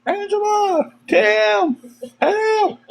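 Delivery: high cries of names and help, with a laugh; imitated calling out. Derek calling his friends from outside, high panicked call. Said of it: "You just hear him go".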